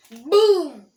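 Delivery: loud mouth gunshot boom; shouted boom. Pretend. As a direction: yell a gunshot noise. Gunshot sound effect.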